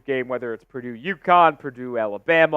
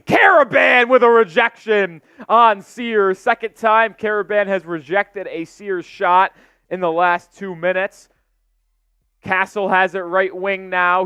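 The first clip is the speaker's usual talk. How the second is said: energetic call, louder on the big block; play-by-play; 5 semitones higher, 6 dB louder than usual. Real, not acted. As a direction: punch the rejection, then keep the call rolling. Live play-by-play.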